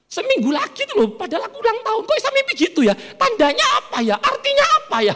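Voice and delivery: high, wheedling questions; hammed-up high feminine hinting; coy wife. Wife asking husband what her birthday dream means, high coy wheedling.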